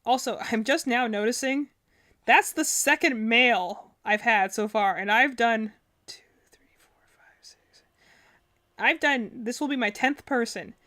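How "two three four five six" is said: counting to herself in a whisper. Real, not acted. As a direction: breathe the numbers out, barely voiced. Counting under her breath.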